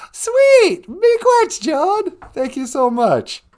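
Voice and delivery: high, loud delighted cheer; thrilled by the donation; excited thanks. Real, not acted. Host thanks Joan for her super chat with a high, loud cheer.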